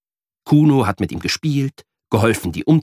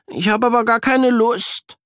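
put-on high child voice, a mild reluctant mumble; sulky little boy who won't go; unwilling kid, only lightly muttered; grumbling boy; 13 semitones higher than usual. A narrator's reading. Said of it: "murmelt er".

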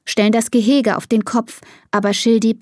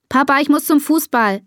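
higher-pitched call to dad; 7 semitones higher than usual. A narrator's reading. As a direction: call it out lightly. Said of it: "ruft Bea".

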